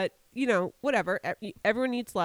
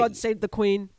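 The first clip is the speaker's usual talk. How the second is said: put-on British accent, louder; fake British accent; 5 dB louder than usual. Joking salute to the Queen, mock British accent. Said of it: "my accent's fake".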